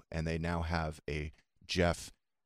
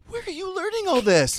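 amazed father; 8 dB louder than usual. Retold or imitated. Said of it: "I was like".

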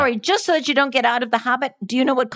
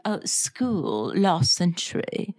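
put-on English accent; 4 dB quieter than usual. Co-host who lived in Britain, keeping up English accent. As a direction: draw out the lofty British accent. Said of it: "her version of an English accent".

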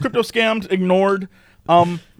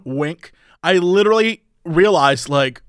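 ordinary talk

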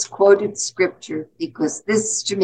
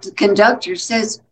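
ordinary talk, nothing marked

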